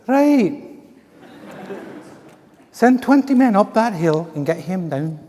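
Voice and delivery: high mock officer in falsetto; falsetto Scottish-English general. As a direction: give the order in Scottish falsetto. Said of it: "with a Scottish accent but falsetto".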